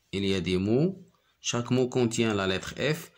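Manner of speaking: usual speaking voice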